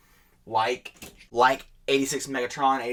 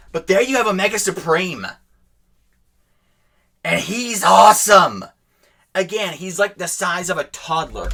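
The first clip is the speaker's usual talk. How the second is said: excited; 6 semitones higher, 6 dB louder than usual. Real, not acted. Toy reveal enthusiasm.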